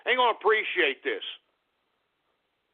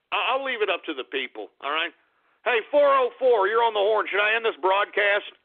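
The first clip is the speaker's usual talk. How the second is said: ordinary talk, nothing marked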